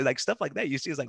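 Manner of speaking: ordinary talk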